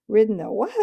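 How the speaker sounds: neighing retort. Acted. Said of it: "it came out as sort of a neigh".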